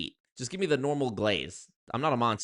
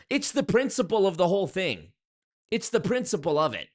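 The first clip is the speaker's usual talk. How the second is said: higher and louder, pressing the point; indignant; 7 semitones higher, 4 dB louder than usual. Real, not acted. Vlogger ranting at viewers, raised indignant insistence. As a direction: insist on the principle.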